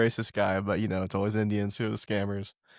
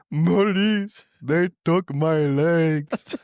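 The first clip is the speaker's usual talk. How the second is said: higher, louder, slow hammed-up plea; mock distressed caller; 6 semitones higher, 5 dB louder, slower than usual. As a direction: wail it like a panicked victim. Absurd distressed call to police.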